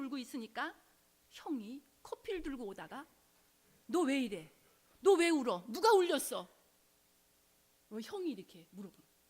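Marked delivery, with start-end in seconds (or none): 3.9-6.5 put-on loud, startled questions from the older brother; alarmed shout. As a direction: call out in shock at someone who is crying. Brother alarmed at crying man. (우는 동생에게 놀라 소리치는 형)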